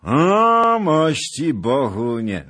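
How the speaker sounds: high, loud mocking hail; hearty greeting. Zagloba hailing Bohun, high and loud. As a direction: call out to him with mock grandeur. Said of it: "rzekł pan Zagloba".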